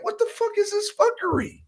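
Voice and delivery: acting out his stunned reaction, way up high; retold disbelief. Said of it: "I'm like".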